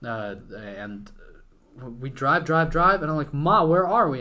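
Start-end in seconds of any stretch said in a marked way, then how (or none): 3.3-4.2 louder, higher retold panic; mock-anxious kid demanding an answer; freaking out. Kid asking mom where they are, mock panic. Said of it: "I'm freaking out".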